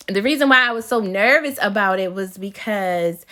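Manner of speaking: ordinary talk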